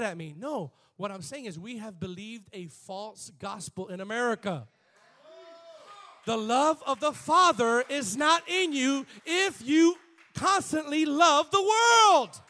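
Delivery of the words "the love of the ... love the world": slow, loud and higher preaching shout; preached shout. Shown as a demonstration. Pastor showing how it sounds when he says it, slow loud shout. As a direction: thunder the verse slowly at the crowd. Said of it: "If I say it".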